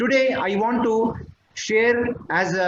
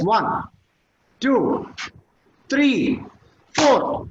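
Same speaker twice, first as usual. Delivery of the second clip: each number louder and higher; counting louder and higher; 3 semitones higher, much slower than usual. Shown as a demonstration. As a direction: build up the count toward five. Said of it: "I count one to five".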